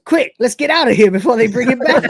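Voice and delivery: high, eager and quick; eager cat voice. Retold or imitated. Cat urging the crew to flee, high and eager. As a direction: pitch it up like a gleeful cat. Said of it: "Cat goes".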